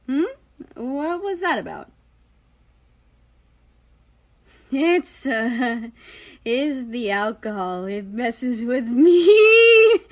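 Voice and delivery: slurred tipsy voice; drunk. Acted. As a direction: drunk and giggly. Drunk character explains alcohol.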